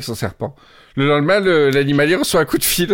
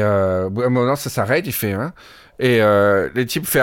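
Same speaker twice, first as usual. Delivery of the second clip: ordinary talk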